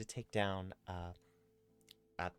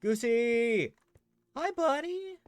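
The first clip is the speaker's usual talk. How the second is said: high, loud happy calls; overjoyed to find the horse; excited calling; 12 semitones higher, 10 dB louder, slower than usual. Real, not acted. Finally finding her lost horse Goosey, excited calling.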